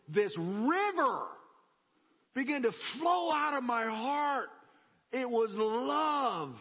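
slow, swelling preacher climax; preaching about love flowing, building to a shout. Real, not acted. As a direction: draw it out and lift to a cry. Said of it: "preaching climax".

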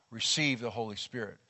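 normal talking voice

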